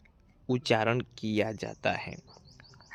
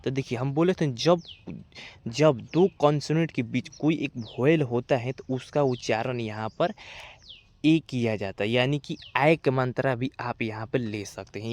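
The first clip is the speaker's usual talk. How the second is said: ordinary delivery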